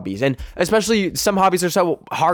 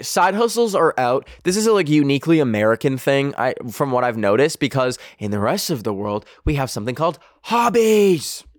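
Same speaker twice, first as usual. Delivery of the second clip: ordinary talk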